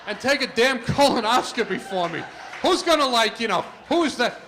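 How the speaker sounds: loud, high, disbelieving rant; loud exasperated thought. Retold or imitated. Thinking about the photo ID rule, in a loud exasperated rant. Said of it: "And I'm thinking".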